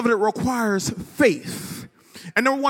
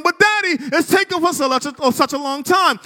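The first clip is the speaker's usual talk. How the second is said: put-on whiny child; 4 semitones higher than usual. Son begging his daddy for the T-Rex now, whiny. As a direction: whine it like an impatient little boy. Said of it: "child's reply".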